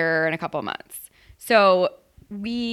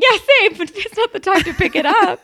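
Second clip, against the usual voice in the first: high, loud laughing agreement; 12 semitones higher, 7 dB louder than usual. Real, not acted. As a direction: laugh through the words.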